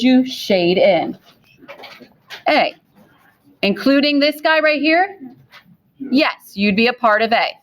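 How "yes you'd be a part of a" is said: usual speaking voice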